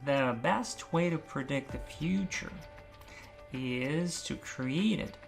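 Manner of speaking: soft, slow, careful phrase; slow practice. Shown as a demonstration. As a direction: practise it slowly and quietly. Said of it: "increase the pace gradually".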